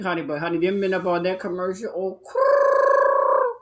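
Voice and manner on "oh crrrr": loud, high rolling crrr of delight; high flirtatious growl; high growly purr. Real, not acted. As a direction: purr like something hot just walked by. Swooning over the men.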